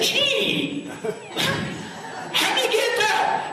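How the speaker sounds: high, amazed American voice, breaking into laughter; American impression. Teller mimics the American at the bar, laughing. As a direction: hoot it like a wide-eyed Yank, laughing. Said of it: "'cause he was American".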